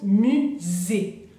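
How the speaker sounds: "musée" slowly, the s buzzed as z; careful French "musée" with a z sound; French pronunciation model. Shown as a demonstration. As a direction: model "musée" as mu-zé, syllables apart. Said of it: "la S es como una Z".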